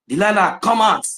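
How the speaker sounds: high taunting call; mocking call. Taunting Delilah to show herself, high mocking call. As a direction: taunt her to come out again. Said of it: "people, you know".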